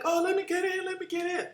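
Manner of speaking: high, pleading girl's voice, mocking; mimicking thirsty groupies at the stage door, high and whiny; begging groupie. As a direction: imitate a desperate fan begging to be let in. Girls begging to get backstage.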